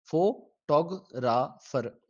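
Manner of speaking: slow, one syllable at a time; split into syllables. Shown as a demonstration. Teacher dividing a word for the class, slowly. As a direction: say photographer slowly as fo-to-gra-far, each beat apart. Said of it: "correct इसकी division क्या है".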